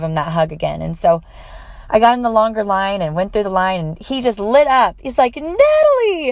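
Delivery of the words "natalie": much higher excited call of her name; retold joyful shout of a name; excited greeting.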